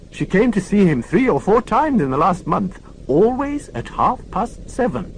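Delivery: put-on a deaf old nurse correcting the inspector; prim old lady. Old lady scolding Morse for shouting.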